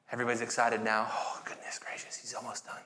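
hushed whispered joke; whispered aside. Real, not acted. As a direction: whisper it to the room. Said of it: "whispered aside to congregation".

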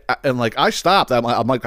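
ordinary talk, nothing marked

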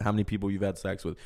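usual speaking voice

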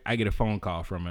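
ordinary talk

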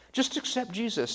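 high-pitched mock southern accent. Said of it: "not like that or in a southern accent".

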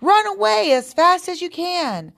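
high-pitched urgent warning call; crying out. A narrator's reading. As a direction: urge a friend to flee, pitched high. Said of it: "cried the animals".

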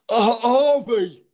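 slow, dopey high "Uh, Harvey"; mock spaced-out lad; dazed slow drawl. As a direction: imitate a coked-up lad mumbling his name. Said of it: "Like that".

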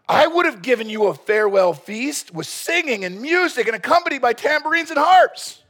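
high, loud indignant father-in-law; Laban demanding. Acted. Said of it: "Laban demanded".

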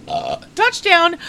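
slow words pushed out on a burp. Real, not acted. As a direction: let the burp roll through the words. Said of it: "Sorry, that was my burp".

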